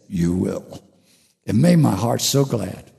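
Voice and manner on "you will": low, soft inner voice; God's voice. Retold or imitated. Speaker voicing God's reply in his head, low and hushed. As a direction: lower and much softer, like a voice heard inside the head. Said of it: "God's voice came into my head and said".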